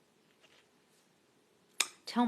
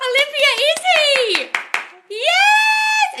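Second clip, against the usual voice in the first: much higher, loud squeal of excitement; loud high delighted squeal; thrilled shriek; 11 dB louder, much slower than usual. Real, not acted. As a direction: shriek it, overjoyed. Thrilled reaction to viewer's news.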